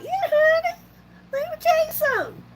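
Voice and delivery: very high-pitched mock comeback; sassy squeaky put-on voice; playful sass. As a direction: pipe it up high, setting the doubters straight. Sassy comeback to doubters of her dancing.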